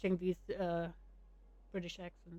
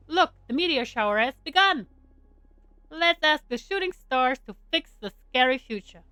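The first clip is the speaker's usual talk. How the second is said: lively, slightly raised exclamation; excited; 4 semitones higher, 6 dB louder than usual. Acted. Character pointing out meteor shower to friends, excited. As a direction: urge friends to look up, excited.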